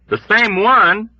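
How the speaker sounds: high, loud, slow quivering echo; quivering excited echo; ominous excitement. Acted. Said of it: "quivering with a deep and ominous excitement".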